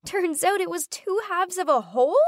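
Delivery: high, thrilled outburst; excited shriek. Acted. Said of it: "She shrieked".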